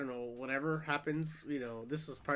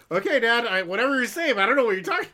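mock denial; 8 semitones higher, 11 dB louder than usual. Son denying to his father.

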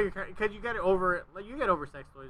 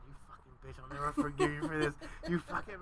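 whispered insult; 7 dB quieter than usual. Retold or imitated. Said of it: "whispering to her".

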